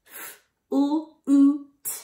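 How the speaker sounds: "F-U-U-T" as separate speech sounds; "F-U-U-T" sound by sound, gaps between; word chopped into sounds. Shown as a demonstration. Teacher segmenting flute for kids, "F-U-U-T" chopped up. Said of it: "you're going to chop it up".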